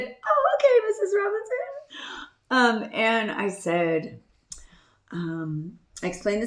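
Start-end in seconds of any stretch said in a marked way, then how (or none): 0.0-1.6 very high mock-polite clerk voice; imitating bank employee. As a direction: squeak it sweetly like an obliging clerk. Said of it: "And she said".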